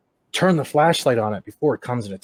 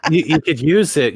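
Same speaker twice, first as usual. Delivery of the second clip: usual speaking voice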